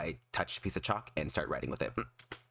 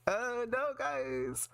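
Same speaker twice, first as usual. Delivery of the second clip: flustered; much slower than usual. Real, not acted. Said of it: "I can feel my face is hot".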